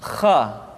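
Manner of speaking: "Kha" with a low, rasped throat fricative; kha from throat base. Shown as a demonstration. Teacher showing where kha comes from, throaty demo. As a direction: scrape "Kha" from the back of the throat. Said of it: "dikeluarkan dari pangkal tenggorokan".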